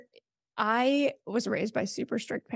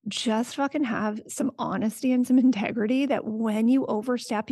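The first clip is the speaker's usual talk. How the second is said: ordinary talk, nothing marked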